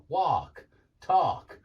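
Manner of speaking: "walk" and "talk" with an ar-sound; mock American. Shown as a demonstration. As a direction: put on an American ar. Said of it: "they use this R sound".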